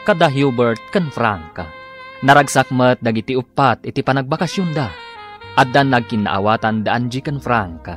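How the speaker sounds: ordinary delivery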